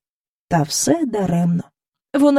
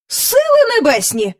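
much higher startled cry; excited exclamation; 14 semitones higher, 3 dB louder than usual. Acted. King jumps to his feet, exclaiming much higher. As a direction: leap up and exclaim. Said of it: "вигукнув".

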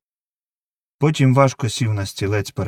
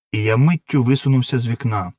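plain reading voice

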